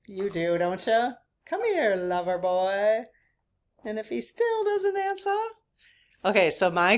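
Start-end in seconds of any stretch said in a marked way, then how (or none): 1.5-5.3 sing-song it out like a film heroine calling her man; Dirty Dancing quote. Retold or imitated. Repeating the movie line for her viewers, a higher playful call. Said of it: "Anyone know what that's from?".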